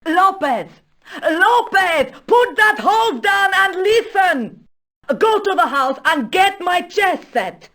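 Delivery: high, loud commanding yell; shouted orders. Acted. Countess ordering servant to fetch chess set, shouting impatiently. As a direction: call impatiently to someone far away.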